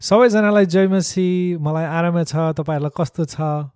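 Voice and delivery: quick, friendly greeting in Nepali to the audience; quick Nepali greeting to the people back home; speaking Nepali. Shown as a demonstration. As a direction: greet them warmly in your own language, at a brisk pace. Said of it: "Could you speak to the people out there in Nepalese?".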